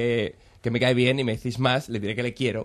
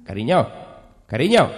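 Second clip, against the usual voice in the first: louder call, rising; 6 dB louder than usual. Acted. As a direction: shout after her. Calling after wife drifting into space.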